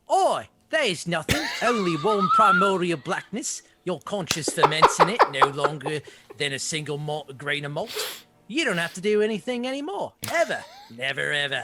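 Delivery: rowdy laughing Cockney read of the same lines; Cockney-ish voice. Shown as a demonstration. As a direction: ham it up like a rough London geezer. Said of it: "Can I give you mine?".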